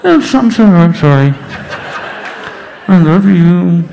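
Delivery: loud, muffled snorkel voice; mock apology muffled by a snorkel; talking through a snorkel. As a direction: talk like through a snorkel tube. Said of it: "I went".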